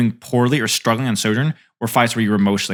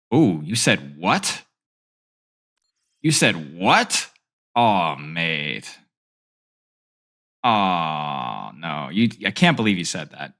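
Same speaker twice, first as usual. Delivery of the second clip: slow, lower, hammed-up mock hurt; mock offended; 6 semitones lower, much slower than usual. Streamer pretending chat insulted him, hammed-up hurt voice. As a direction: play wounded at an imagined insult, overdone.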